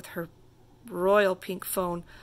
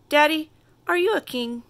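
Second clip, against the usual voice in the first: eager question; 7 semitones higher, 7 dB louder, faster than usual. A narrator's reading. Girl asks father eagerly.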